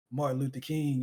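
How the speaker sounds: ordinary talk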